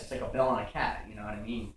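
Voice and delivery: usual speaking voice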